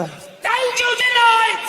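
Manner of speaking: high, drawn-out vendor shout; big mock sales cry, pitched high; confident usher's call. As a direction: holler it slowly across the theatre like an usher. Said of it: "It's a very confident usher out there".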